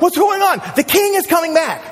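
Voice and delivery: high-pitched, excited calling out; acted excited villagers, pitched high; excited townspeople. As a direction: cry out the news to the whole town. Townspeople react to trumpet.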